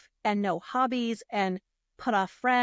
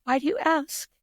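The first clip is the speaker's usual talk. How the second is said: higher-pitched, held-in question; holding it together; 5 semitones higher than usual. Retold or imitated. Teacher to boss, about to cry, holding it together. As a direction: ask it while keeping tears back. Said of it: "so I held it together".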